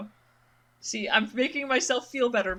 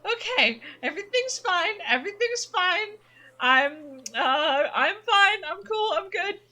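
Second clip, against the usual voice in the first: nervous reassurance; 9 semitones higher than usual. Real, not acted. Startled by creepy voices.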